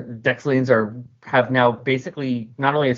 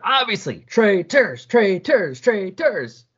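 crowd yelling; 8 semitones higher, much slower than usual. Acted. Angry villagers yelling at Stealth, a raised chant. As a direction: hurl the word at her like a mob. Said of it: "they start yelling at her".

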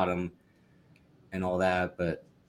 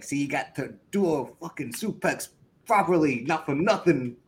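put-on New York accent; 6 semitones higher than usual. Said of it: "he doing every New York accent".

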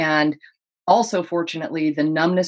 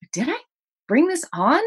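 sudden self doubt; 10 semitones higher than usual. Retold or imitated.

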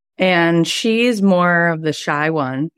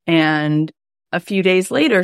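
ordinary talk, nothing marked

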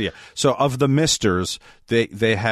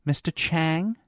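small, higher voice with a questioning rise; petite voice; 6 semitones higher, 4 dB quieter, slower than usual. Retold or imitated. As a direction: imitate a nurse's petite, tentative call. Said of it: "she had a very petite voice".

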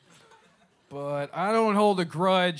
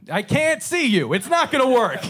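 high-pitched yell to the back; calling out; 9 semitones higher, 6 dB louder than usual. Real, not acted. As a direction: shout it out to someone out of sight.

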